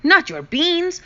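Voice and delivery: high, loud outraged yelp; outraged cry. Acted. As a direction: shout it in shock. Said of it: "cried Skipito, outraged".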